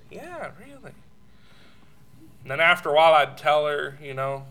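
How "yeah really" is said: soft, high, swooping coo; sweetly insist, very softly; reassuring husband. Retold or imitated. Husband reassuring doubtful wife: acted soft cooing.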